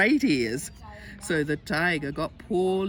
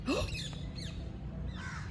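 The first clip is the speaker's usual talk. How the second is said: soft, breath-only storytelling gasp; shocked gasp; 10 dB quieter than usual. Acted. Reader reacting to all the tigers, soft gasp. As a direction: suck in air in mock shock.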